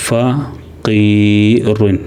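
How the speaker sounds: ഫഖീറുൻ very slow, ഖീ held long; teacher reading ഫഖീറുൻ slowly; slow model reading. Shown as a demonstration. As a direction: draw ഫഖീറുൻ out syllable by syllable. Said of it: "ഫഖീറുൻ എന്ന് വായിക്കാം".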